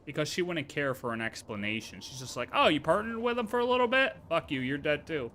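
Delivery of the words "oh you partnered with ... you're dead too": normal talking voice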